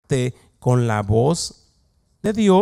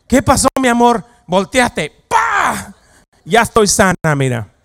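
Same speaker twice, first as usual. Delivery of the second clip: excited exclaim; 8 semitones higher, 8 dB louder than usual. Acted. Acted healed wife after kick.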